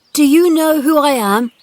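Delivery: high, loud and self-important. A narrator's reading. Sick boy confronting the old gardener, importantly. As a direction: announce yourself like a little prince. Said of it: "Colin said importantly".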